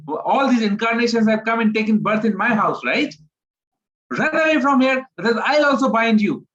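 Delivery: high, loud, shooing scold; Yashoda's mock scolding. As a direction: chase him off like an annoyed mother. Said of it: "Mother Yashoda says".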